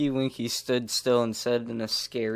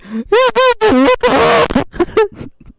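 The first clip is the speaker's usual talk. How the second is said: high squealing laugh; shrieking laugh; 14 dB louder than usual. Acted. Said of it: "as she said".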